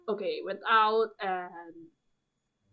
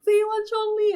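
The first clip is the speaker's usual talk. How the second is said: high, loud triumphant call; triumphant cheer; 12 semitones higher, 7 dB louder than usual. Retold or imitated. Gamer to viewers, proud of her pull, triumphant cheer. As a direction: announce it like a victory.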